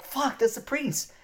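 high, drawn-out exclamation of recognition; retold stunned realisation. Remembering who the stranger is, retold as a stunned exclamation. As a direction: re-enact a sudden startled realisation.

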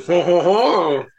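mock laugh. Real, not acted. Teasing laugh at internet dating.

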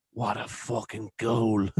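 slow, quiet, sly jab at his back; drawn-out sarcastic praise behind his back; mock compliment. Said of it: "they went around".

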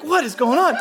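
high bewilderment. Retold or imitated. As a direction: toss it up high in disbelief. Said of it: "I'm like".

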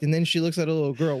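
usual speaking voice

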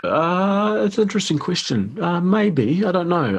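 very high, hesitant answer; high-pitched hedging. Real, not acted. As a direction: pitch way up, stalling on a tricky question. Put on the spot by interviewer's question.

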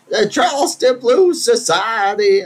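high, slow put-on voice of a woman plugging her society; imitating the lady. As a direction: ham up a pompous announcement. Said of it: "She's like".

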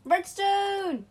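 high, loud thrilled cry; excited shout. Real, not acted. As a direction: shout out the find. Excited find.

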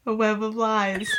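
a light take on a movie trailer announcer; ominous trailer narration, lightly done. Pretend. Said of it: "like, movie trailer voice".

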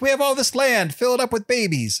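high-pitched mock enthusiasm; eager parents. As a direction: imitate parents excitedly pushing for babies. Voices eager grandparents-to-be.

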